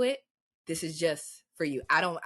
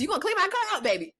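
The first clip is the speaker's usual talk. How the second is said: thrilled flirt; 12 semitones higher, faster than usual. Retold or imitated. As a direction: gush like someone offered a favour. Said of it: "imagined flirty reply".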